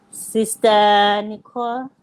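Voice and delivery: usual speaking voice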